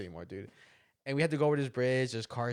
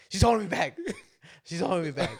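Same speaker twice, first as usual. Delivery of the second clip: loud joking complaint; 7 semitones higher, 9 dB louder than usual. Retold or imitated. Joking complaint about sister.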